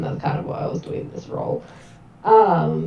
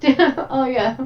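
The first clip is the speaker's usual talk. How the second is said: ordinary talk, nothing marked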